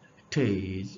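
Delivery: heritage cut down to its stressed, falling latter part; second-syllable stress. Shown as a demonstration. Teacher repeating for students, heritage stressed after its first syllable. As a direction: drop the start of heritage and stress the end. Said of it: "শেষের সিলেবলে জোর দেয়া".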